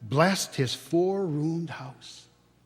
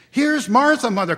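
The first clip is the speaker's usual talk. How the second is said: put-on high, loud excited children; children crying out; 8 semitones higher, 10 dB louder than usual. A narrator's reading. Little children greet their sister, high excited cries. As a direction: shout it gleefully like small kids. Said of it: "cried the two young Cratchits".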